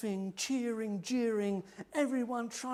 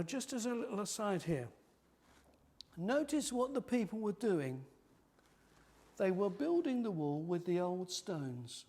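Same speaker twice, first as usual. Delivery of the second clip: normal talking voice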